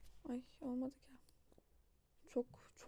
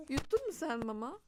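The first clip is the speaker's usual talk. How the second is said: high playful mock questioning; 4 semitones higher than usual. Real, not acted. Mother asks her baby if he swallowed the food, playful baby talk. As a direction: ask him with a teasing lilt.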